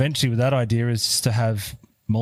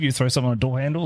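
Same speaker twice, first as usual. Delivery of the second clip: ordinary talk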